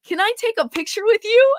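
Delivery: high, loud imitated asking; eager request. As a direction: pipe up with an excited question. Acts out the player's eager request.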